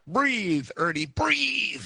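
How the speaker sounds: son's high, slow coaching plea; urging. A narrator's reading. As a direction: son coaching the lizard through labor, high and drawn out. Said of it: "he urged".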